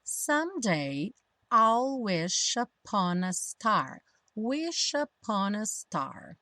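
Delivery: slow "wish upon a star" flowing as one word; all linked together. Shown as a demonstration. Teacher modelling lyrics, "wish upon a star" slowly run together. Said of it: "tudo juntinho".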